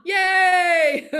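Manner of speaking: loud high whoop of joy; cheer. Real, not acted. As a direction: celebrate like you just won. Cheering her own point.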